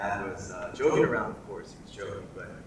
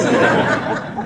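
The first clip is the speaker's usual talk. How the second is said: sudden high unvoiced laugh; real laugh breaking through; burst of laughter; 11 dB louder than usual. Real, not acted. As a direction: let a loud laugh burst out. Laughs at his sunburned face.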